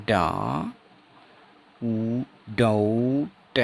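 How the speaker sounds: डाउट stretched out, डा then उ; sound by sound. Shown as a demonstration. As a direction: break डाउट into slow long sounds with no b. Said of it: "इसको क्या बोलेंगे". (डाउट को खींचकर, टुकड़ों में)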